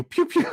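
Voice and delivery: high, playful pew pew sniper noises; mock gun sounds. Streamer fake-snipes his rival's pick with high pew pew sounds. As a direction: fire off a pretend sniper shot.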